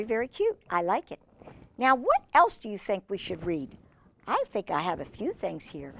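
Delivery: ordinary delivery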